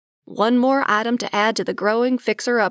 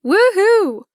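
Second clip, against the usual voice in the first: cheering; 11 semitones higher, 6 dB louder than usual. Acted. Celebrating brother still employed.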